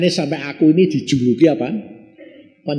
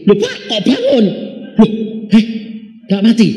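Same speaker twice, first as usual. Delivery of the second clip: higher, louder startled question; amazed he is still alive; surprised; 7 semitones higher, 3 dB louder than usual. Retold or imitated. Family asking the pastor why the man woke, startled.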